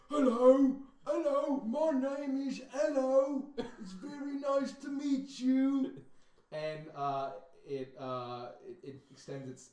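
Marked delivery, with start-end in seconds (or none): 0.1-5.9 much higher, slow and loud greeting; a big friendly brute trying to be polite; big lizard voice. Acted. The large lizard greeting the party, high and slow. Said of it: "the, uh, larger one says".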